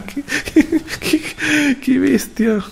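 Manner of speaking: very high, words broken by giggles; real laughing fit. Real, not acted. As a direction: struggle to speak through laughter. Cracks up during the match.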